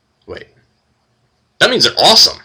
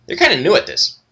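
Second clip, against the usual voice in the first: a lightly higher, chirpy kender line; kender aside; 9 semitones higher than usual. Acted. Tass tells the party about the gnome greeters, lightly high. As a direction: toss it off like an amused little kender. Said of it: "Tass says".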